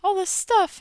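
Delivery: a hint of a disappointed follower; mock hurt follower. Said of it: "just was like".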